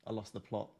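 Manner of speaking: usual speaking voice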